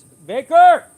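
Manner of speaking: loud high call across the yard; full-voiced shout for the dog. Real, not acted. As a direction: yell the dog's name to bring him back. Calling dog back outside.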